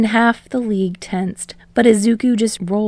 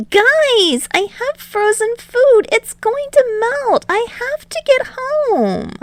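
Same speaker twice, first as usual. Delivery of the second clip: high, rising pleading whine; begging to be let go home; pleading teen; 3 dB louder than usual. Acted. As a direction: Izuku pleading with the villains, high and whiny. Izuku voiced high.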